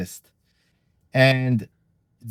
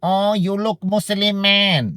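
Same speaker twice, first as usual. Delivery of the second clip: put-on higher, slow, lightly accented girl's voice; mock Thai girl's voice; Thai girls teasing; 5 semitones higher, slower than usual. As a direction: tease him slowly, like a local girl. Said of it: "a lot of them would be like".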